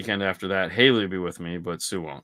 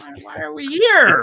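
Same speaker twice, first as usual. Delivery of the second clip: much higher and louder, fed-up disbelief; a son fed up with his mom's indecision, exasperated; exasperated outburst; 9 dB louder than usual. Retold or imitated. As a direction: throw up your hands: why are we even here. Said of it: "And I'm just going".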